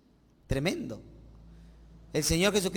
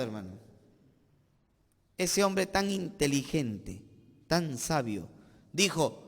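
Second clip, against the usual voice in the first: ordinary delivery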